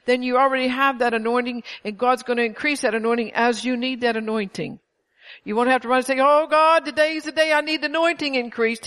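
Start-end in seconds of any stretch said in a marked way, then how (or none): 6.2-8.9 higher, louder begging prayer; pitched-up mock cry to heaven; mock desperate prayer. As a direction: ham up a frantic plea to God.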